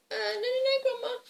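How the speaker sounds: high-pitched innocent denial; guilty little girl. Acted. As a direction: squeak out a fib to grandma. Girl denies opening the box.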